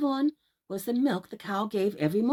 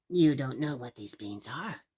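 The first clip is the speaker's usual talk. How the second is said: put-on old man; 7 semitones lower than usual. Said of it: "said the man".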